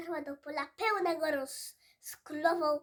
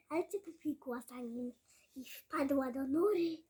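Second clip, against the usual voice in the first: plain reading voice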